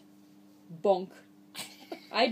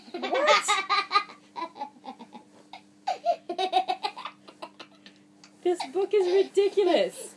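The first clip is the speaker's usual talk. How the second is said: higher, louder, laughing protests; mock exasperation; 7 semitones higher, 3 dB louder than usual. Reader protesting more pages to the child, mock exasperation. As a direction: complain in a whiny raised voice.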